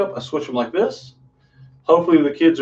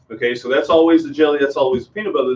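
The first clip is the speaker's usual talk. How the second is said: normal talking voice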